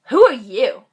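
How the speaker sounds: loud, snarly challenge. Retold or imitated. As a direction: imitate someone being snarly and dismissive. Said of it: "he did really snarly".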